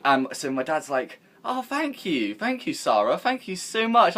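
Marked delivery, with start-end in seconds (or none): 1.4-4.2 mock grateful dad. Dad thanking mum for Xbox.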